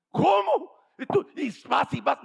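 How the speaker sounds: high, loud astonished cry; shocked neighbour. Retold or imitated. Preacher voicing the startled neighbour, high and loud. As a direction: jump up in pitch in disbelief.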